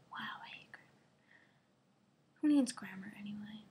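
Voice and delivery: very soft unvoiced whisper; whispered aside. Real, not acted. Vlogger whispering her dislike of grammar, hushed. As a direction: hiss your grudge under your breath.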